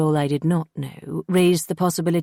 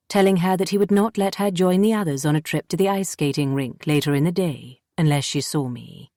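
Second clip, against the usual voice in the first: ordinary delivery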